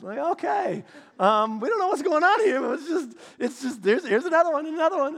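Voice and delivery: high, loud exasperated comic rant; hammed-up overwhelmed parent; mock bewildered. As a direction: throw up your hands, another one.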